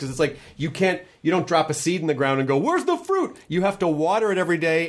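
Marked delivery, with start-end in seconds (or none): 2.6-3.4 high, impatient demand; mock impatient asking. Impatient gardener expecting fruit.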